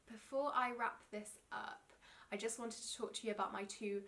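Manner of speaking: usual speaking voice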